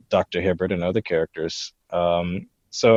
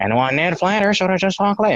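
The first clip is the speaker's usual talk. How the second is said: high, youthful cartoon impression; Ned Flanders; 9 semitones higher than usual. Recalling his terrible teenage attempt at the character, high immature impression. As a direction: imitate an early attempt in a high, undeveloped voice. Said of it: "my voice hadn't developed yet".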